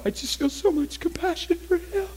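imitating a man sobbing, high-pitched; high, tearful wail of a retold crier; mock bawling. Weeping man explains himself to the preacher, mock bawling. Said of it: "starts bawling uncontrollably".

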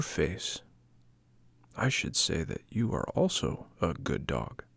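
smooth, quiet flattery from a schemer; flattering polecat. A narrator's reading. Polecat flattering the new watchdog, softly and slyly.

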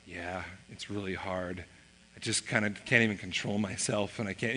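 very soft, low confession; subdued, halting and helpless; retold defeated admission. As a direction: imitate a kid quietly giving in. Son admits he is struggling.

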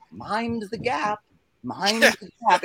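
high, very slow imitation of a recorded woman; British woman announcer. Imitating the British train voice, high slow woman announcer. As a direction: stretch it out as a female station announcer. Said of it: "it's like a woman's voice".

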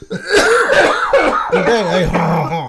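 very loud, hammed-up French-Canadian accent. Host redoes his Canadian with the forgotten French accent, hammed-up French. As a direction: redo it as an exaggerated French Canadian. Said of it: "I forgot my French accent".

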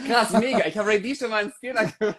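hoch, lachend, schnell; aufgeregt lachend. Real, not acted. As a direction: lacht überrascht los. Realizes he forgot, laughs. (aufgeregt und lachend)

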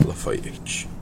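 unvoiced menacing whisper. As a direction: breathe the name as a threat. Threat said under breath.

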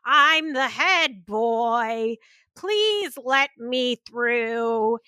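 slow, drawn-out self-important announcement; mock officious prefect clearing a crowd; Percy. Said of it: "Percy comes through, and he's like".

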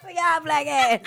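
laughing through the words. Real, not acted. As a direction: say it while cracking up. Repeating own joke, laughing.

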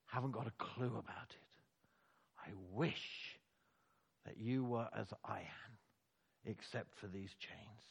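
hushed intensity. Real, not acted. Preacher drops to a hush.

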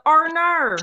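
high, drawn-out Australian "Arnar"; Australian accent. Shown as a demonstration. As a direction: exaggerate an Australian "oh no". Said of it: "as good as your Australian accent".

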